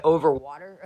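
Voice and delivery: loud disbelieving retort; incredulous over water. Retold or imitated. To the gas station clerk, loud incredulous retort. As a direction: throw the question back in disbelief.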